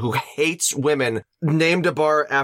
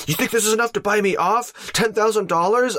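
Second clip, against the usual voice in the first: raised-pitch, affronted movie hero; big indignant moment; 8 semitones higher than usual. Retold or imitated. Hero rejecting the dad's payoff, mock indignant. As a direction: push it up high, incredulous. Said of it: "he has this big moment where he's like".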